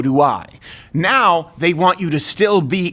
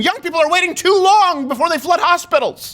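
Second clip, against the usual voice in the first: mocking high news voice, much higher; mock news anchor; 11 semitones higher than usual. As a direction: parody a breathless news report. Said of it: "they're saying".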